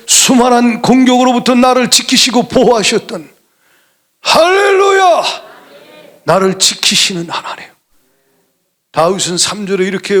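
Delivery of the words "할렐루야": high, slow, stretched cry of praise; a pastor crying out to the church in joy; ecstatic hallelujah. Real, not acted. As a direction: lift the whole room with it. Preacher calls out to congregation.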